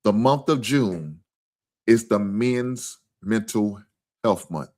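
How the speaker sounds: "The month of June is the Men's Mental Health Month" said very slowly, word by word; slow emphasis. Shown as a demonstration. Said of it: "Let me say that again".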